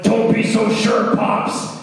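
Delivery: a hint of a spooky voice; creepy tease. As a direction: tease the dad like a monster. Monster teases the dad.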